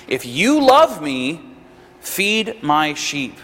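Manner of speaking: "feed my sheep" spaced out slowly, word by word; slow right down to drive home "feed my sheep"; slow emphasis. Retold or imitated. Preacher quoting Jesus to Peter, slow emphasis on "feed my sheep". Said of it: "to really emphasize things".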